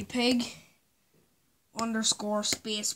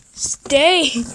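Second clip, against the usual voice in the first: loud, drawn-out raised order; shouted command; 5 semitones higher, 16 dB louder than usual. Real, not acted. Vlogger ordering the setup to stay, shouted command. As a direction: shout it at something that won't stay put.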